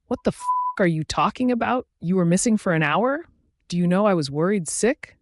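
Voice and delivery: high-pitched, restrained narrator's shout; outraged, incredulous questions without full volume; angry shouting. A narrator's reading. Alpha confronts his missing mate, shouting in worry. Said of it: "he's shouting at the top of his lungs".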